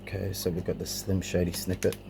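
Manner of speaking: ordinary delivery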